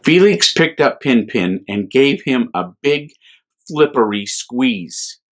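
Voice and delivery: gentle, lively bedtime reading voice; children's story reading. A narrator's reading. A priest reading a picture book to children, gently. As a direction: read it to little ones with wonder.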